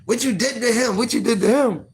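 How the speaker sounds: high, fast, repeated accusing question; grandma confronting the grandson, high and insistent; grandma's alarmed question. Retold or imitated. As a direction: demand it over and over like a worried granny. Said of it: "she was like".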